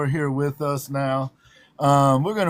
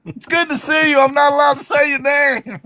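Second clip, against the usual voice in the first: playful shout; 9 semitones higher, 6 dB louder than usual. Real, not acted. Joking greeting to chat member.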